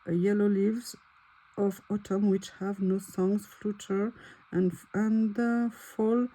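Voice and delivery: reads the English poem in her French accent; a careful French reader stumbling through English verse. Real, not acted. Reading an English poem aloud to viewers, French accent. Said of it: "excusez-moi pour mon anglais, hein, parfois les accents ne sont pas très bons".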